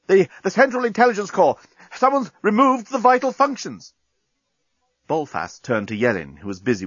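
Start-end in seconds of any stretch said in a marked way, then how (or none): 0.1-3.9 high, breathless panic; nervous and out of breath. A narrator's reading. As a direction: stammer it out, panicked. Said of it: "out of breath and nervous".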